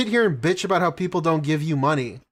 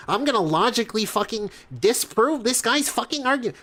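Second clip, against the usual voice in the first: higher, whiny know-it-all imitation; mock nerd voice; 7 semitones higher than usual. Mocking the rival as a smug nerd, higher and whiny. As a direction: sneer at him like a smug nerd. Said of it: "fucking nerd emoji".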